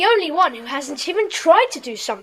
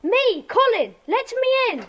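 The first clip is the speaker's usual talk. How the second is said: urgent plea at door; 7 semitones higher, 4 dB quieter, slower than usual. Acted. Hurt boy at door begging entry.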